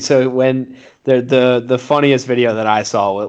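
ordinary delivery

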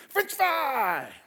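put-on little boy blurting it out high and eager; kid's excited shout. Boy answers the pastor's question, excited kid voice. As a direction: chirp it like a happy small child.

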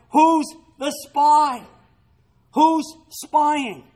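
loud, high, slow demand; a king roaring at his officers; furious king. Acted. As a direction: bellow it like an enraged king. Pagan king's angry demand.

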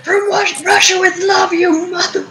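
high-pitched shouted war cry; shouting it far above normal pitch; mock shout. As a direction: yell it out like a taunt from a plane. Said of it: "just sort of shout something like".

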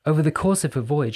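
ordinary delivery

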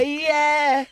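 very high, loud mocking yes; eager mock agreement. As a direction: squeal agreement like a clueless friend. Mimics friend agreeing without understanding.

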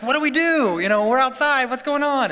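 high, loud imitation of a bewildered little kid; excited three-year-old. Little son asking dad about the hike, loud imitated excitement. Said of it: "he's like".

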